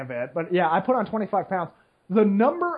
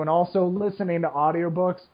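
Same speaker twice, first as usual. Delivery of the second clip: ordinary talk, nothing marked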